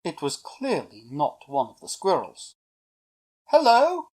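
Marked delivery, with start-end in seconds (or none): 3.5-4.1 high, loud hello; bright-pitched holler upwards; calling up. A narrator's reading. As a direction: call out to someone high in a tree. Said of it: "Eric called up".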